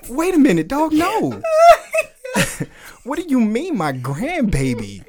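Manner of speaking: mock disbelief. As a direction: act out being stunned and offended. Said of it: "was like".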